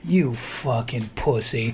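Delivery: low, slow put-on buddy voice; imitating his buddy. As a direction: draw it out low and slow, mimicking a friend. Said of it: "he goes like this".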